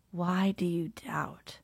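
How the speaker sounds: slow soft whisper; gentle whispered rebuke. A narrator's reading. Jesus catching sinking Peter, slow whispered rebuke. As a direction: ask it quietly, almost tenderly.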